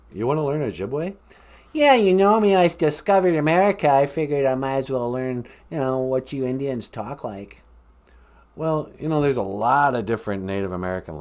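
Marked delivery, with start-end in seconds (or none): 1.7-7.6 brash fake Boston caller; Boston accent. Columbus boasting of discovering America, put-on Boston accent. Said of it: "talk with my Boston accent".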